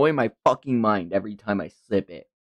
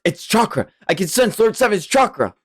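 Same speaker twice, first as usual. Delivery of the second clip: raised-pitch excited realization; urgent discovery; 8 semitones higher than usual. Acted. Boy senses Lord Seventh's chakra, urgent and high. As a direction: announce the discovery with rising urgency.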